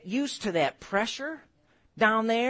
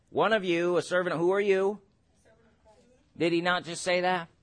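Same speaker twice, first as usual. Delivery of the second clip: ordinary talk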